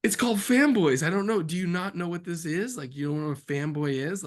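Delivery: high, loud exasperated pleading. Retold or imitated. Filmmaker defending the title Fanboys, exasperated. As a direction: plead with the studio in disbelief. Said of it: "You're like".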